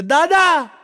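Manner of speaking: loud desperate third shout. Retold or imitated. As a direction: yell for grandpa at full voice. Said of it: "મેં દાદાને ત્રીજી બૂમ પાડી".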